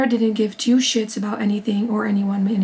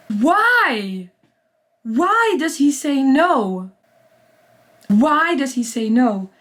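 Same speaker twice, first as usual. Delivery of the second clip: real outraged disbelief; 3 semitones higher, much slower than usual. Real, not acted. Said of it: "I'm so mad right now".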